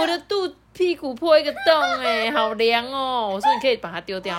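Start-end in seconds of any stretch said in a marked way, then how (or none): 0.0-3.3 lightly voiced child, higher pitch; higher-pitched mock little-kid voice; child calling mom. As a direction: imitate a kid reporting to mommy, drawing out the end. Mother voices her child's complaint.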